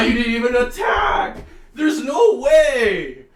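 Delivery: high, loud outraged yell; loud enraged protest; furious shout. Real, not acted. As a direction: shout in real disbelief at the screen. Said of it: "I'm actually heated".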